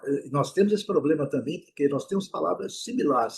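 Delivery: usual speaking voice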